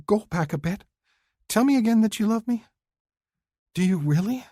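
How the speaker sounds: high, quick, eager woman's voice; eager young woman. Acted. Woman urging her lover to repeat his love, high and eager. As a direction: she needs to hear it again. Woman asks to hear it again.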